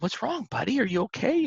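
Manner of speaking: put-on father gently checking on his sobbing toddler, tender high voice; gentle concern. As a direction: ask like a dad kneeling by his crying boy. Dad comforting crying son.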